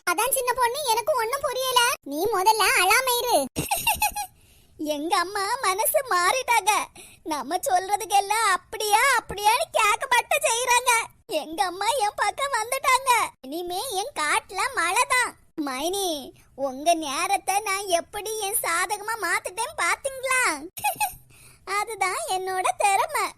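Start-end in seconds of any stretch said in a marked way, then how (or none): 3.4-4.2 gloating laugh. Acted. Schemer gloats over winning mother. 13.5-23.3 smug gloating. Acted. Gloating aside to sister-in-law.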